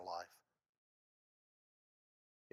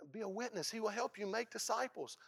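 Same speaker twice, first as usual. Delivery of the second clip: normal talking voice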